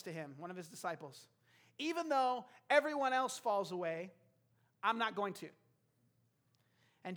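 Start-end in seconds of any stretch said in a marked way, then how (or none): 1.8-5.5 loud, higher boast of loyalty; acting out a confident vow; emphatic conviction. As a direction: declare it with total certainty. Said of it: "Peter was convinced of his heart, his commitment".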